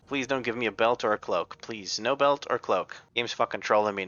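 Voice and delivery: usual speaking voice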